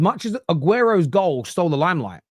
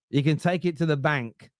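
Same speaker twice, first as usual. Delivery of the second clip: ordinary delivery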